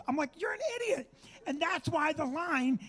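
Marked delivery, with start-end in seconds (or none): 0.4-1.1 high-pitched mock disbelief; exasperated retort. Comic telling off a clueless traveler, exasperated retort. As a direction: squeal the insult in exasperation.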